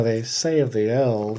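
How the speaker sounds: slow, low and hushed lore-telling; portentous storyteller. Shown as a demonstration. Cohost showing how the fanfic title should sound, slow portentous intoning. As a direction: intone it like an old legend. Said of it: "it should be said, you know".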